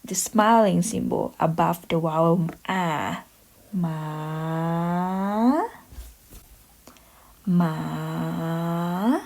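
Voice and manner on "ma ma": "Ma" dips low, then rises at the end; Thai rising tone. Shown as a demonstration. Teacher showing students the rising tone, dip then rise. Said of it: "rising tone".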